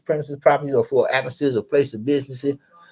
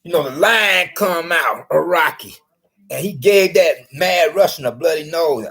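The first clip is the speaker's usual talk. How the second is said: ordinary delivery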